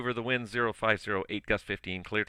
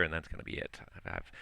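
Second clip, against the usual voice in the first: hoarse, worn-out voice; losing voice; 3 semitones lower, 8 dB quieter than usual. Real, not acted. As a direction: voice giving out, strained. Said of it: "I'm losing my voice".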